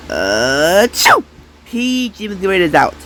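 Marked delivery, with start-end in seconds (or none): 0.9-1.2 very high, sharp sneeze. Acted. Sick toy sneezing out PG. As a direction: pop out a quick sneeze. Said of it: "I'm sick".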